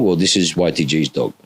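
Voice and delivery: usual speaking voice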